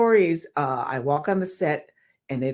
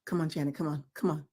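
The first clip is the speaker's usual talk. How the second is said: softer, coaxing encouragement; mock urging; 3 semitones lower, 9 dB quieter, faster than usual. Fellow dancers softly urging her on. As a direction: urge her on under the breath. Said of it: "dancers were like".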